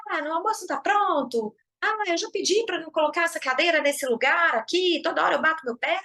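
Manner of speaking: mock angry shout at home; irritated yelling. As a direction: nag like an irritated partner coming home.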